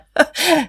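short, high acted laugh. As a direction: burst of mocking laughter, pitched high. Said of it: "Jetzt lachte Guste laut". (kurzes, hohes Auflachen)

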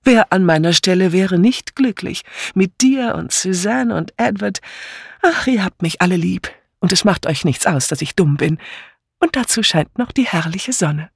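hohe, selig schwärmende Ehefrau; zähle dein Glück freudig auf; glückliche Pfarrersfrau. Acted. (glückselig)

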